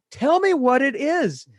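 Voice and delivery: imitating the wife demanding an answer; demanding shout. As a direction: force the answer out of him. Argument escalating to its peak.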